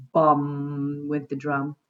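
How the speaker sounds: slow playful booming; booming drum sound. Pretend. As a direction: make the word boom like a bass drum. Sound words of crashing instruments.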